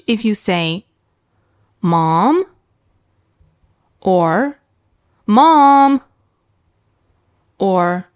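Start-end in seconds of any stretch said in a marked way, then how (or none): 1.8-2.4 Mom said low, rising at the end; "Mom" starting low and lifting upward; questioning tone on Mom. Shown as a demonstration. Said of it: "they all mean the same thing". 5.3-6.0 Mom held high and louder, level; "Mom" high and drawn out. Shown as a demonstration. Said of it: "they all mean the same thing".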